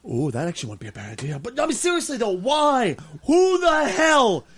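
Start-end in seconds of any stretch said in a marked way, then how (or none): 1.4-4.4 slow, high, loud outraged question; exasperated rant. Real, not acted. Host ranting at a news site, outraged. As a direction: demand it in disbelief. Ranting about parenting article.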